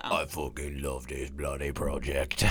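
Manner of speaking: slow, deep mock Hagrid accent. Podcaster doing Churchill as Hagrid, deep mock accent. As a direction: growl it out like Hagrid. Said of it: "he's not Hagrid either".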